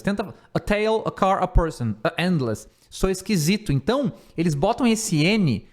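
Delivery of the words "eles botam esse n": ordinary talk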